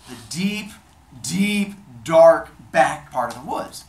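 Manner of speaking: loud, slow, word-by-word build; scheming emphasis. Acted. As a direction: dwell heavily on each ominous word. God lures her to remote woods.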